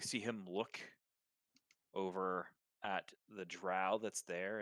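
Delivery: normal talking voice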